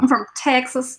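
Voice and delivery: mock Southern country accent; Texan accent. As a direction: say it like a proud Texan. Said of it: "the South country".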